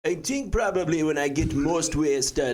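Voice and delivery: playful put-on Jamaican accent. Performer naming drunk accent, Jamaican accent. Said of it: "more of a Jamaican".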